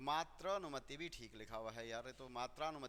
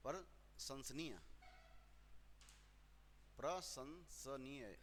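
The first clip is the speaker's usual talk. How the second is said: प्रशंसनीय drawn out slowly, syllable by syllable, and softer; slow careful repeat; 7 dB quieter, much slower than usual. Shown as a demonstration. Teacher repeats the answer for students, slow and soft. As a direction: spell out प्रशंसनीय slowly and softly, pra-shan-sa-nee-ya. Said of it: "प्रशंसनीय होता है".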